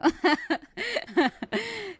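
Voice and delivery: high, open laugh; real burst of laughter. Real, not acted. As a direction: genuine amused laugh. Laughing at iced coffee.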